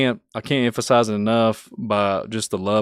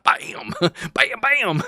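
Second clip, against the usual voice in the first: rapid bams; 11 semitones higher, much slower than usual. Retold or imitated. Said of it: "Bill's like".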